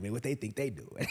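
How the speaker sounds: lightly voiced sneer, lip curled; sneering scoff. Korah's family mocks those moving out, sneering. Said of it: "And lip turned up".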